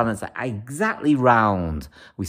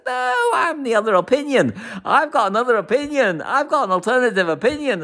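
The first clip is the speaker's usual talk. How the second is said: high, emphatic claim of being the other opinion; high-pitched triumphant protest; defiant declaration; 7 semitones higher than usual. Retold or imitated. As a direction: announce it proudly, pitched up. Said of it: "Johnny Waxman says".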